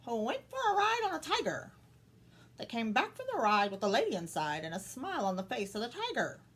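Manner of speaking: high parrot voice, squawking the rhyme. Acted. Parrot mocks the girl from the rafters, high and squawky. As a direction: screech the limerick down from the rafters. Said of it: "squawked the parrot".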